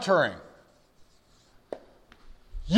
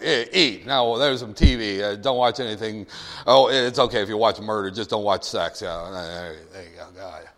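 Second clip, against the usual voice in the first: low, offhand dismissal; mock indifferent parent; 7 semitones lower than usual. Neglectful parent sending the kid to the T V, acted indifference. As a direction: wave the kid off lazily.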